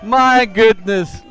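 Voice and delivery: slow, loud, stretched-out shout; drawn-out excited shout. Real, not acted. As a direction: draw out each word in awe. Commentator shouts at big moment.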